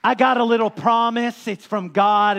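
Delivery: loud, ringing preacher's cry; preaching at full force. Acted. Pastor preaching loudly to his congregation. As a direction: proclaim it to the whole church.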